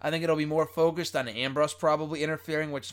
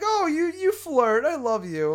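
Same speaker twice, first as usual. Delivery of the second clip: high, slow gushing imitation; mock her flirting, high and drawn out; exaggerated high-pitched flirty voice; mock Lana; 10 semitones higher, 4 dB louder, slower than usual. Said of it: "And she's like".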